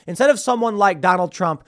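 ordinary talk

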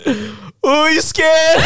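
mock him, giggling at his fear; teasing Puerto Rican woman. Woman on the subway platform teases him about the mouse, laughing. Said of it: "Puerto Rican woman behind me turns around".

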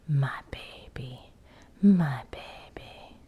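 slow breathy whispered crone; Ghost Keeper voice. Acted. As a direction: whisper it like a ghostly old woman. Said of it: "you very much have the Ghost Keeper voice going on".

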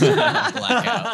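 high, loud call ending the scene; gleeful announcement. Acted. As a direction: announce the blackout with a laugh. Ends sketch as stage direction.

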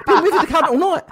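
high, loud, laughing disbelief. Real, not acted. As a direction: laugh through the words.

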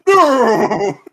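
high yelled no; pained refusal. Retold or imitated. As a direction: yelp it out, struggling. Victim refusing in headlock.

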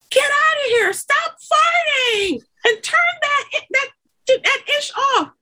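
wife yelling at farting husband to get out; 1 mock yell at husband. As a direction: shoo him out, half yelling.

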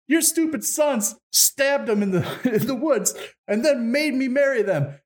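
high-pitched mock indignant lady; accusing bride. Lady denounces the sons to the king, mock indignation. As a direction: complain hotly in a put-on high voice.

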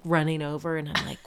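usual speaking voice

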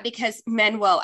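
ordinary talk

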